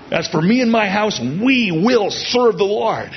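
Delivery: high, emphatic declaration; certain, expectant. Acted. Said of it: "he says with certainty, expectancy".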